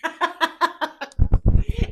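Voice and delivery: loud, high laughing fit; big burst of laughter. Real, not acted. Vlogger cracking up after her own impression. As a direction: crack up hard.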